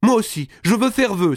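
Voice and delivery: put-on very high, excited girl's cry; high-pitched delighted outburst; eager exclamation. A narrator's reading. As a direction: burst in, keen to join the vow. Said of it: "s'écria en battant des mains".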